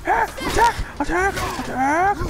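frantic excited yelling. Real, not acted. Excited frantic fighting in game.

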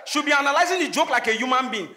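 ordinary talk, nothing marked

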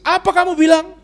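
high, loud challenge; indignant retort. Acted. Husband takes wife's words seriously, indignant outburst. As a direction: raise pitch and volume, affronted. Mock angry retort to wife.

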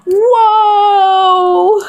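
loud, high, amazed whoa; surprised whoa. Real, not acted. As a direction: gasp it out in wonder. Mom reacting to a gift.

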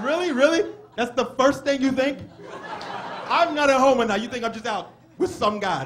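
high, loud protesting questions; indignant disbelief. Acted. Son reacting to dad's assumption, hammed-up indignation. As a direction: throw it back as a scandalised son.

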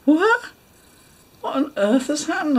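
high, loud startled question; mock-astonished outburst; acted bewilderment. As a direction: shoot up in baffled disbelief. Acted alarm at people pointing.

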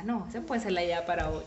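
ordinary delivery, nothing marked

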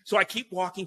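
ordinary delivery

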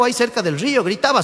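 normal talking voice

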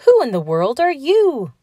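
high-pitched, baffled demand; astonished cry. A narrator's reading. Donald questions the tiny stranger, astonished cry. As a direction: cry it out in disbelief. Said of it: "he cried".

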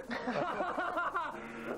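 put-on drunken laugh. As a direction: laugh like you've had too much. Drunken cackle at table.

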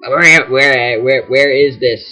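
usual speaking voice

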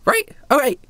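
high-pitched, peppy phone chatter; chirpy girl on a call. Acted. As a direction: chat perkily into a communicator as a young girl. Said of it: "some sort of communication device".

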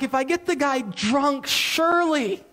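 high, smug plotting voice; scheming thought. Retold or imitated. King plotting to get the soldier drunk, high smug voice. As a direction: savour the sneaky plan. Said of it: "He's like".

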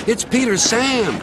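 high cry of alarm; wailing. A narrator's reading. Empty cars warn of the wrong engine, wailing. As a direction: pitch it up as a desperate warning. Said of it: "wailed the empty cars".

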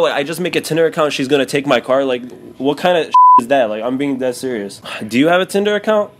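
usual speaking voice

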